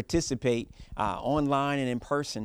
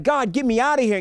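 pleading; 13 semitones higher, 10 dB louder than usual. Retold or imitated. Voicing a desperate prayer.

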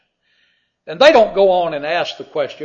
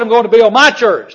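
full-force preaching; 8 semitones higher, 13 dB louder than usual. Acted. Preacher proclaims Peter's confession to the congregation, shouting. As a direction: declare it like Peter's great confession.

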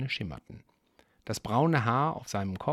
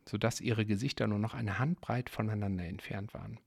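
plain reading voice

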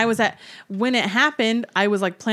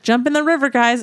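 mock call out; 5 semitones higher, 6 dB louder than usual. Imagined pioneer mom calling kids.

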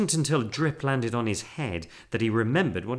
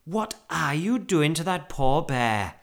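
higher, slow scolding; shocked cry; 5 semitones higher, 4 dB louder, slower than usual. A narrator's reading. Wife scolding husband over the bear, dismayed cry. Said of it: "cried Missus Brown".

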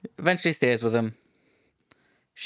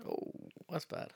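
breathy whisper, barely there; soft whispered dismay; 18 dB quieter, slower than usual. Real, not acted. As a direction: whisper it like a shocked aside. Reacting to bad French accent.

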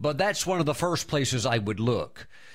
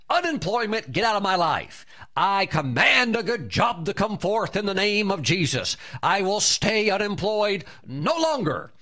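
commanding confession; 5 semitones higher than usual. Shown as a demonstration. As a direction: declare it over the mountain with full authority. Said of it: "your voice to the mountain must be".

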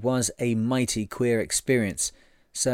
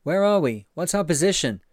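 raised, higher hail; calling out; 7 semitones higher than usual. A narrator's reading. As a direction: shout the question over the water. Hailing the schooner from the boat.

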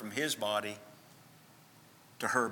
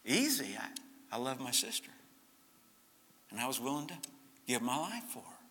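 softer, a gentle hushed reply; quiet boy's confession; 8 dB quieter than usual. Retold or imitated. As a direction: let the boy say it softly and simply. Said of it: "And he said".

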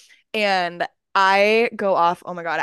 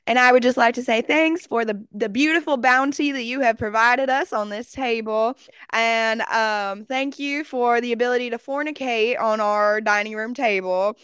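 mock-solemn Southern table blessing; put-on Southern prayer voice; Southern grace; 3 semitones higher than usual. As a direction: keep a straight face through a Southern-accented grace. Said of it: "like, Southern, like, giving thanks".

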